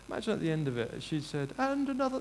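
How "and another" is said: high-pitched mock royal voice; the Queen. Preacher imagining the end of a royal speech, regal. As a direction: put on a regal, elevated tone. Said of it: "this is my Queen impression".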